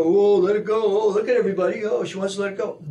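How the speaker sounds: loud, high, taunting bully voice; mock them like a schoolyard bully; hammed-up bully jeering the one who objects. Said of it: "That's the bullying part".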